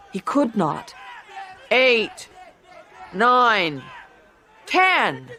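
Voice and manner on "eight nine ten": referee count. A narrator's reading. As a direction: count him out firmly.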